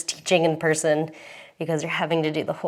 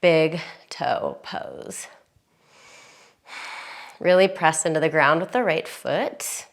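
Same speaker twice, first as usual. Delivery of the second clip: calm yoga instructor. Acted.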